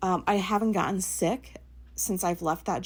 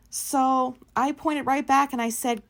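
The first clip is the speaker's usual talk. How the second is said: usual speaking voice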